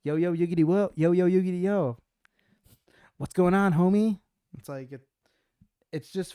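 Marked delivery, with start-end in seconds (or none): 0.0-4.2 high, loud, goofy impression of a buddy; friend's phone voice. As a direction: answer the phone as a hyped-up pal. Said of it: "answer the phone for someone".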